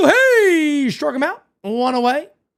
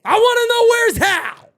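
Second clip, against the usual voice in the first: yelling at TV; 9 semitones higher than usual. Real, not acted. Yelling at the TV show.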